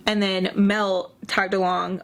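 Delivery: ordinary talk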